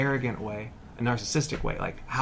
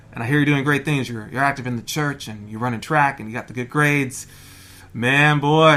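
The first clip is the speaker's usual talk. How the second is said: loud, booming encouragement; hearty retold praise; 2 semitones higher, 9 dB louder than usual. Provost phoning a college senior to recruit him, loud and hearty. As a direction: pile on the praise, building in volume.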